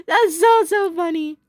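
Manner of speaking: high, breaking into real giggles; laughing. Real, not acted. Fan amused by the zombie faces, laughing. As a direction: squeeze the words out through laughter.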